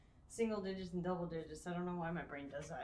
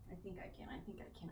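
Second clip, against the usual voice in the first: soft, low murmur of encouragement; hushed self pep talk; 3 semitones lower, 12 dB quieter, much faster than usual. Real, not acted. Streamer coaching herself before heavy set, hushed murmur. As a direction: quietly psych yourself up before the lift. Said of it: "can I do it?".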